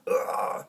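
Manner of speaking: low throaty grunt; guttural grunt. Shown as a demonstration. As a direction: drop into a gut growl. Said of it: "too much of the".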